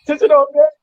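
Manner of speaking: loud, high imitation of a sergeant; called-out command. Sergeant walking in on sleeping recruits, shouted command imitated. Acts sergeant entering barracks before dawn.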